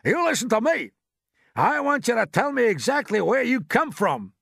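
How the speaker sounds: high, loud, emphatic order; shouted demand. A narrator's reading. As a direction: holler a demand up to the top. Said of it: "shouted the chief of police".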